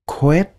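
"quét" said short and rising, like Vietnamese; first part "quét" on its own, rising; first syllable demo. Shown as a demonstration. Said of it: "Các bạn đọc là".

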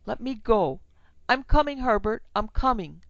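high, loud, calling out to her son; mother calling to her son; desperate calling. A narrator's reading. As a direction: break free and call to him. Wife breaking free for son.

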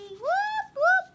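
very high, loud celebratory whoops; whoop whoop cheer. Real, not acted. Crafter cheers a duplicate card with very high loud whoops. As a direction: cheer like at a party.

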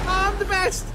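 high, loud gloating cheer; triumphant shout. Real, not acted. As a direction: crow about winning. Gloating after success.

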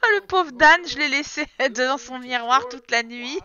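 loud, laughing wail; laughing pity. Real, not acted. Player realising Dan stood at the mirror all night, laughing pity. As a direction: cry out in amused pity, slowing through laughter.

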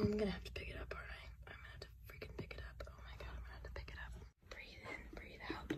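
soft, fast whispered dread; real nervous whisper before touching the mouse. Real, not acted. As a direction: whisper yourself through the disgust.

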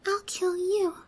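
ordinary talk

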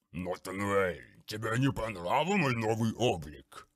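gurgle it out like a rotting beast; Grulgar's gurgling voice. Acted. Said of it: "пробулькал омерзительный голос". (булькающий, омерзительный голос)

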